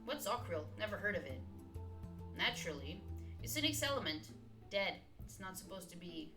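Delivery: ordinary talk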